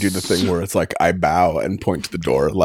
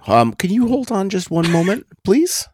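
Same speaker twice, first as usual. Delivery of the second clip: overly polite; 11 semitones higher than usual. Acted. High polite stalling voice.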